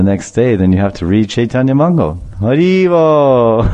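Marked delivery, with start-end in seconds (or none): 2.4-3.6 long drawn-out, higher call; slow exultant exclamation; devotional cry. Real, not acted. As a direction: call it out to the congregation, stretching the vowels. Devotional exclamation closing the tale.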